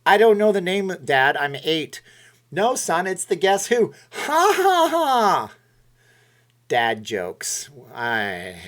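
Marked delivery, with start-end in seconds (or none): 0.1-2.0 mock whiny kid, higher and much louder; a little boy fed up with his dad's quiz, loud and high; exasperated kid. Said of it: "I'd be like". 2.5-3.9 a higher, louder hint of a pleased dad; lightly voiced dad delivering his joke; dad's punchline. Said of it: "he'd be like". 4.1-5.5 very high, loud hammed-up ha ha ha; mock dad laugh. Dad laughs at own joke.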